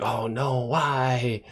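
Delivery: soft, lamenting murmur; mock dismay. Host voicing a viewer hit by a death, softly dismayed. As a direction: imitate a hushed, dismayed viewer. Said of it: "and you're just like".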